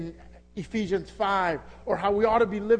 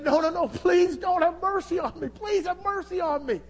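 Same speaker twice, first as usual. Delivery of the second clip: higher, desperate begging servant; pleading servant; 10 semitones higher, 4 dB louder than usual. Acted. Servant begging his master for mercy, pleading higher. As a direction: beg the master for mercy, voice pitched up. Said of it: "the man pleaded".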